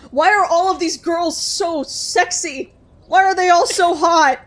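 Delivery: gushing. Real, not acted.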